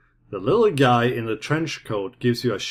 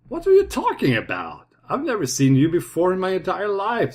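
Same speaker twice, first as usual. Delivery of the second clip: higher nerdy voice; dorky guy voice; 6 semitones higher than usual. Acted. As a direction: play it as a flustered dork. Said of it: "says the dorky-looking guy".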